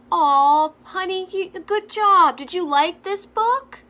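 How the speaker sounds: put-on high, gushing mommy voice praising a toddler; mock sweet mom. Mocking a mom cooing at her toddler after reading. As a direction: coo "good job" like a doting mother.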